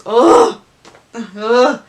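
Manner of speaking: loud groan of dismay; big exasperated "ugh". Real, not acted. Exasperated at scarred face.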